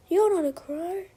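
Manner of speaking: high-pitched, contained storybook scream; indignant crow outcry, only mildly screamed; mild angry scream. A narrator's reading. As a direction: cry it out high as the angry crows. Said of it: "they scream".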